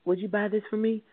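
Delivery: a hushed plea for help at the store; talking real low. Retold or imitated. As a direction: ask it low, almost shyly. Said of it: "she talking real low and stuff".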